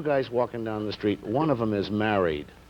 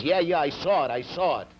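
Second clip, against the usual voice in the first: impatient, fed-up brush-off; weary irritation, pitch a little raised; disgusted; 6 semitones higher than usual. Acted. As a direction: cut off the stutterer in mild disgust. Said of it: "the other guy's disgusted and says".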